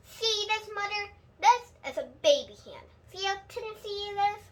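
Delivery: put-on a kid showing off to her mother; little girl's voice. Child shows baby's hand.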